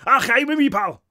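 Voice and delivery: high, loud, thick Scots greeting; Scottish accent. A narrator's reading. Barry being introduced to the children, thick Scottish accent. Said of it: "Barry is Scottish".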